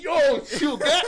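high, loud, laughing through it; laughing plea. Retold or imitated. Narrator laughing as he hushes his friend, high and loud. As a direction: giggle out a plea to calm down.

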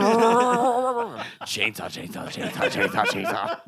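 buzzing mock chainsaw noise; chainsaw impression. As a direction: rev it like a power saw. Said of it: "Is that how it sounds?".

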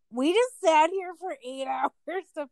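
loud, high, drawn-out complaint; laughing through mock-outraged protest; exasperated outrage. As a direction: act out indignation at a wasted wait. Retold outrage after hospital wait.

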